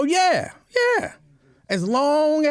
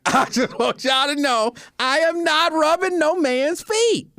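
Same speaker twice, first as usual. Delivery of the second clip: high-pitched, insistent declaration; emphatic disclaimer; 9 semitones higher than usual. Real, not acted. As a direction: set the record straight, pitched way up.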